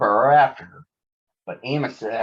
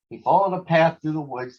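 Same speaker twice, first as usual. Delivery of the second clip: plain reading voice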